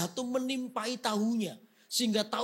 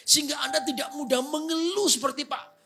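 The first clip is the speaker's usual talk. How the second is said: loud, high, driving sermon; full-force sermon climax; fiery preaching; 7 semitones higher, 6 dB louder than usual. Real, not acted. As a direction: preach it to the whole congregation.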